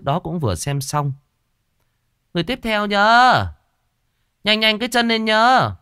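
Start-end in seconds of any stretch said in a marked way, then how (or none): 2.3-5.7 high, loud call from the next room; shaman calling out. Acted. Shaman calling from inside for the next client, loud and high. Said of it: "Giọng cô Sinh vọng ra".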